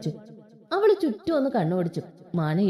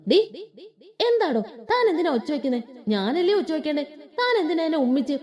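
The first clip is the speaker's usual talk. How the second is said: higher, half-amused protest; indignant young man; 5 semitones higher than usual. Acted. Young man protests to the girl who shouted, lightly voiced. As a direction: act out a startled, scolding hero. Hero scolds girl after kiss.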